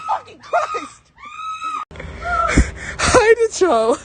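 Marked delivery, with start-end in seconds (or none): 0.1-1.0 very high shrieking laugh. Real, not acted. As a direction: shriek with shock. Reacting to the bird.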